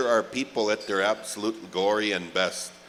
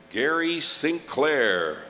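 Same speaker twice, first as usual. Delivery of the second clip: slow name call; 4 semitones higher, much slower than usual. Acted. Announcer calling honoree.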